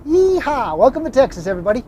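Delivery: high, loud cowboy greeting; fake cowboy accent. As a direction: whoop like a rodeo host. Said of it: "Did you like that?".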